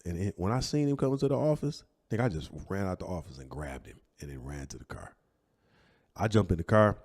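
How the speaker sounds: normal talking voice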